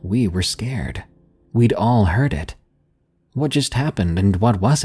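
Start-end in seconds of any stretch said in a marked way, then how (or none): none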